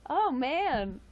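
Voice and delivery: loud, high, laughing oh man; laughing exclamation. Real, not acted. Cracking up at a chat reply, a loud laughing oh man. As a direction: let the laugh burst out of it.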